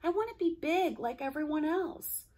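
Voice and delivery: high, quick little-car voice; a small streetcar pleading to himself; young voice, thinking aloud. Acted. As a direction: rush it out, hurt and stubborn. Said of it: "Charlie thought to himself".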